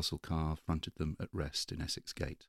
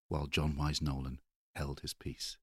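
usual speaking voice

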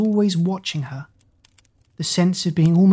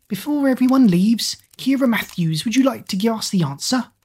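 high, thin squeaky voice; squeaky teacher; 7 semitones higher than usual. A narrator's reading. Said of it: "Miss Ing squeaked".

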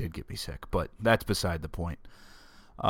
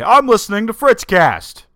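much higher, much louder proud call; shouted out the window; 12 semitones higher, 11 dB louder than usual. Pretend. Listener calls out to people on the street, loud proud shout. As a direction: yell it proudly at the passers-by. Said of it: "roll your window down and say".

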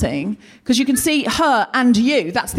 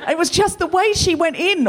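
ordinary talk

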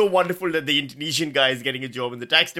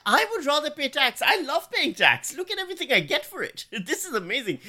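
much higher, over-the-top gushing; gushing about tax; 11 semitones higher than usual. Real, not acted. Comedian hams up loving paying tax, high gushing delight. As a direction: gush like tax is the best deal ever.

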